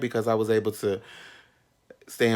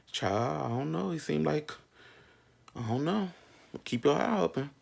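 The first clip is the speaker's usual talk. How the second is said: soft, higher, secretive; hushed warning; 5 semitones higher, 5 dB quieter than usual. Retold or imitated. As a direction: lean in and warn quietly. Friends warning.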